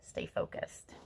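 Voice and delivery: soft whispered echo; whispered repeat. Real, not acted. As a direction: whisper it as a secret reminder. Repeats card advice as whisper.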